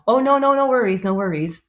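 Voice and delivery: bright, high, dismissive doctor; breezy reassurance. Retold or imitated. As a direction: brush off the worry cheerfully. Surgeon dismissing her worries.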